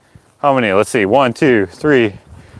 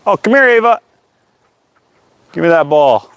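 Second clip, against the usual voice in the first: calling the dog; 6 semitones higher, 4 dB louder than usual. Real, not acted.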